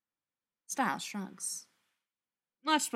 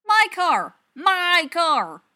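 loud, high outraged insistence on my car; yelling my car, my car; indignant yell; 9 semitones higher, 12 dB louder, slower than usual. Acted. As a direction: shout that it's yours. Stiles insists it is his car.

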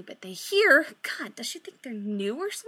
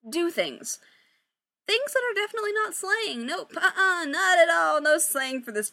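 higher, awkward with nervous laughs; awkward nervous Victoria; 5 semitones higher than usual. Acted. Victoria awkwardly lying to her band about a break. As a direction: fake casual, giggling through the lie. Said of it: "She kind of laughs awkwardly".

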